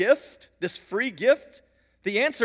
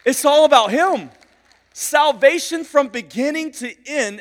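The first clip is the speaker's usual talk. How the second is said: loud, raised, impassioned pulpit delivery; forceful sermon climax, pitched up; full-force preaching; 4 semitones higher, 7 dB louder than usual. Real, not acted. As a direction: drive each phrase home to the whole room.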